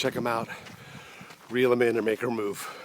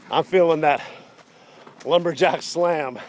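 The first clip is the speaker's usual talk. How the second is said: breathing hard while climbing; panting between words uphill; 5 semitones higher, 6 dB louder than usual. Real, not acted. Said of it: "I can hear you breathing".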